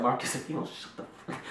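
whispered threat to brother; angry whisper. Retold or imitated. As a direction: hiss the threat so dad won't hear. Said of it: "I was like".

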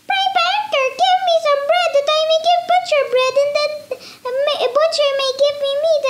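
high, half-sung pleading mouse; squeaky sing-song mouse. Acted.

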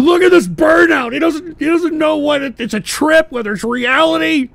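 high-pitched, excited commentary; real giddy high-pitched outburst; amused exclaiming. Real, not acted. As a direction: crow over the spectacle, voice high. Amused, exclaims over tripping guest.

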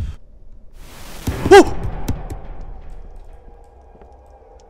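long sharp frightened gasp; terrified gasp. Real, not acted. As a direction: gasp at a jump scare. Big jump scare.

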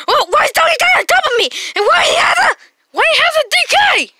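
high, loud, fast alarmed shout; high panicked squeal; shocked outcry. Pretend. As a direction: yell it in disbelief. Finds Donkey Kong on top.

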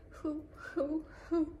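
high storybook boo-hoo sobs; short pretend sobs, one after another; mock sobbing. A narrator's reading. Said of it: "sobbed the fox".

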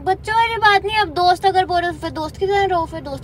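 loud, fast, heated comeback; angry retort. Real, not acted. Girlfriend arguing back at boyfriend over being called friend, loud angry retort. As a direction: fire back at him, hurt and loud.

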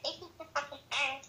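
mustache twirling villain cackle; evil laugh. Acted. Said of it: "Que risada maligna".

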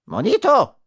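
high, indignant cry; offended little man's outburst; angry call. A narrator's reading. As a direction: snap back in high-pitched anger. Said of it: "rief der Kleine zornig".

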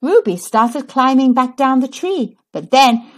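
plain reading voice

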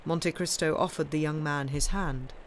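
ordinary delivery, nothing marked

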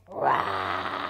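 long, low, rough roar; pretend lion roar. Janet meets the lion by the shore, play roaring. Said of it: "don't forget to roar".

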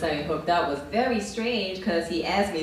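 normal talking voice

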